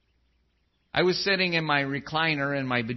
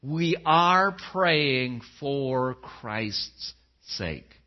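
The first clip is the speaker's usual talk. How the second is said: very slow, dropping on sake; deliberate solemn stress; slow emphasis; 3 semitones lower, much slower than usual. Shown as a demonstration. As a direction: stretch it out to drive it home. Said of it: "concept I want you to remember".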